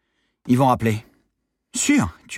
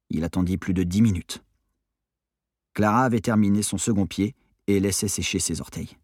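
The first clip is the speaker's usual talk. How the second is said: usual speaking voice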